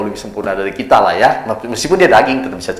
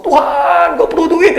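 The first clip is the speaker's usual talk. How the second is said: much higher, whiny pleading to God; mock begging prayer; 4 dB louder, slower than usual. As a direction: beg God for cash, hammed-up. (doa memelas yang dibuat-buat, jauh lebih tinggi)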